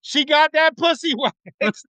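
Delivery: high, slow yell breaking into a laugh; mock catcall with laugh. Man yelling at women leaving the salon, laughing. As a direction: holler it, cracking up. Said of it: "I just wanna start yelling out my car".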